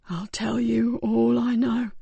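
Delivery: slow breathy whisper. Acted. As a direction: whisper it weakly, barely there. Dying man, unvoiced.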